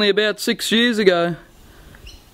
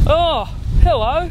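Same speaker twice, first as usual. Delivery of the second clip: surprised greeting; 7 semitones higher, slower than usual. Acted. Grandpa greets long-unseen grandson.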